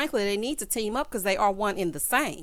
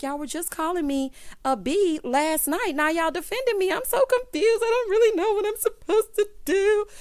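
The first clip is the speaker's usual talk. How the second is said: high mock whine of a confused cast member; mock Keely confused; 9 semitones higher than usual. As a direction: play her bewildered, voice rising higher. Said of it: "And she's like".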